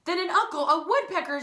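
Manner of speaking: plain reading voice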